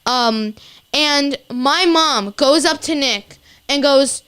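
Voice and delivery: ordinary talk, nothing marked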